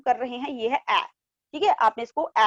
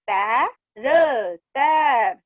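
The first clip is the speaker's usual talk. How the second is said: "तै... र", then a long "तैर"; split then joined; 3 dB louder, much slower than usual. Shown as a demonstration. Teacher leading the class along, "तैर" in pieces then whole. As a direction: sound out "तैर" slowly, then blend it. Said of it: "मेरे साथ साथ आप फिर से बोलिए".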